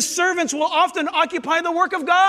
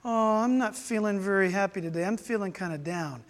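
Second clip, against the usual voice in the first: mildly glum; 7 semitones lower, 5 dB quieter than usual. Acted. Acts out a gloomy believer.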